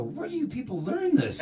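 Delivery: loud, very high incredulity; incredulous outburst. Retold or imitated. Patient baffled by nurse's question, incredulous outburst. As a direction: blurt it out in mock exasperation.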